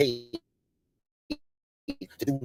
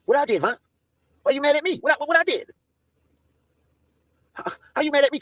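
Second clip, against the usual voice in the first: higher, whiny plea of innocence; mock innocent whine; 11 semitones higher than usual. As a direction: play the wrongly accused. Pleading with Von on stream.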